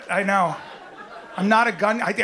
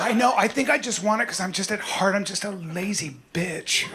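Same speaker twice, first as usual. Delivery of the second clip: ordinary talk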